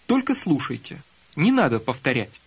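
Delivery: ordinary delivery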